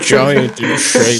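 very slow menacing villain line; slow dramatic villain. Pretend. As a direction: drag it out like a boss. Describing Vulcan Raven dramatically.

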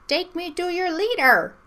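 high-pitched alien robot voice; weird megaphone voice. Acted. Fairy talks through a megaphone to fool the vowels, weird high voice. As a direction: put on a strange disguised voice, pitched well up. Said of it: "her voice was all weird".

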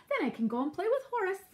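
high, quick, eager piglet; bubbling with excitement; excited squeal. A narrator's reading. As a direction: rattle it off with high-pitched glee. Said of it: "Boris squealed excitedly".

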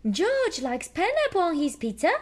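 high, loud little piglet voice; Peppa voice. A narrator's reading. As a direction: tell it like an eager little pig girl. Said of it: "Peppa says".

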